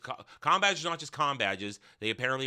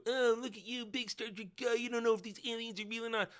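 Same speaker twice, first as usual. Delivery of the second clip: mock nitpicking fan; 9 semitones higher, 5 dB quieter than usual. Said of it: "point at me and go".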